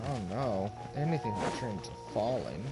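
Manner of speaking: ordinary delivery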